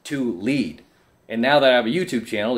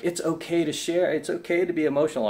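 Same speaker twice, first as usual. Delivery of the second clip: mocking commenters; 6 semitones higher than usual. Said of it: "they're like".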